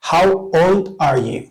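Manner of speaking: unhurried and clear; slow model. Shown as a demonstration. Teacher modelling a question for students, slowly. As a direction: say it slowly for learners to repeat. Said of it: "teacher models the question".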